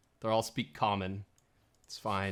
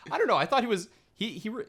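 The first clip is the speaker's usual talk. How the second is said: ordinary delivery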